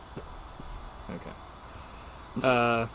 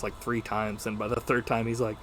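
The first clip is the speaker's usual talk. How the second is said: normal talking voice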